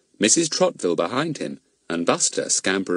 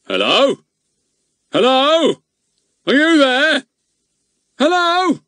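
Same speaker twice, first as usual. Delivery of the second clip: higher, louder bellow down the phone; 8 dB louder, slower than usual. A narrator's reading. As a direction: yell hello into the receiver. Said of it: "began to bellow into it".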